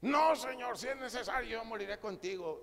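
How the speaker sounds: high protest, bursting out loud then easing; Peter blurting out. Acted. Disciple contradicts the Lord's prediction, impulsive high-pitched protest. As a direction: rash disciple protesting his loyalty. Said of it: "se soltaba muy rápido".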